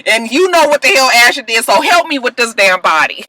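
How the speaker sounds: high, heated, rapid-fire telling-off; imitated angry tirade; acted scolding. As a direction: berate them in mock exasperation. Acting out Annalise berating the kids.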